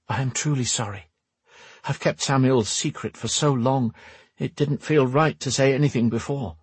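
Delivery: apologetic noblewoman. Acted. Voices Lenora in the story.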